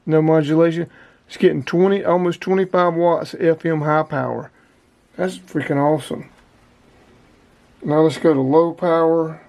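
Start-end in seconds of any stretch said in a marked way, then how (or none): none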